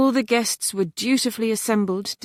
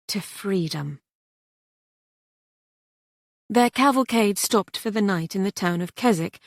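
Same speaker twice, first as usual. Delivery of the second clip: usual speaking voice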